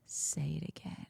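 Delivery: low, hushed imitation of a suitor; seductive whisper. Bachelor to contestant after the kiss, imitated whisper. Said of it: "And then he says to her".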